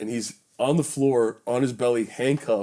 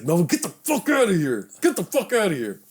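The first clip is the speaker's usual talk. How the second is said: higher, emphatic tour-manager yell; retold angry order; 7 semitones higher than usual. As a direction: act out hauling a friend away with a sharp order. Tour manager drags him away.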